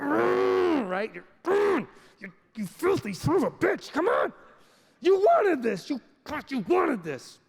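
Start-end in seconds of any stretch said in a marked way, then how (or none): 0.0-0.9 high loud strained grunt; straining grunt. Acted. Straining to squeeze the tube. 1.4-7.4 higher, louder strained yell; straining yell at toothpaste. Acted. Yelling at the toothpaste tube.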